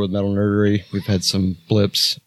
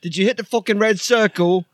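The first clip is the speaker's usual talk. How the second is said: [name] screaming; 12 semitones higher than usual. Acted. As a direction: yell at the lads about the button. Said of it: "Why are you screaming?".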